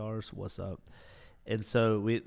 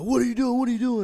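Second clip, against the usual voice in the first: much higher, loud demanding yell; cadets yelling; 14 semitones higher, 9 dB louder, faster than usual. Retold or imitated. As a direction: shout it like a cadet stopping a trespasser. Cadets confronting him.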